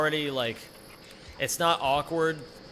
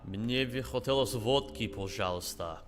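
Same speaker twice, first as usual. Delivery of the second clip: throaty, back-of-the-mouth Russian accent; exaggerated Russian accent, made in the throat; thicker Russian accent. Shown as a demonstration. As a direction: darken the vowels for a Russian accent. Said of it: "back of the mouth and throaty and".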